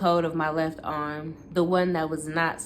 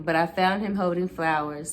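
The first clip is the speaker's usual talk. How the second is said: plain reading voice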